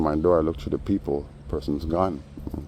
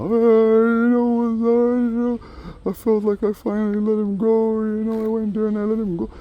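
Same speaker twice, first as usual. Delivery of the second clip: mock fake crying; 5 dB louder than usual. Teller mocks the man faking tears over his brother, falsetto whine. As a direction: put on a tearless weepy show. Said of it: "Acting like he's crying, but no tears".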